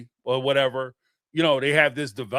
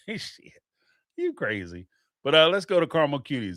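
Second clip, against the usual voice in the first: ordinary delivery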